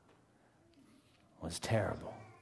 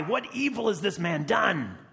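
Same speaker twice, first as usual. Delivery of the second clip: impassioned protest; 9 semitones higher, 3 dB louder than usual. Acted. Pilate's protest to the crowd.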